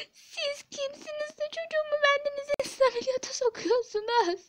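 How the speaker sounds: high-pitched crying protest; tearful reproach. Acted. Mother reproaching staff over unapproved surgery, crying. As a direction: wail the accusation through tears. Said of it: "Hanımefendi ağlamayın".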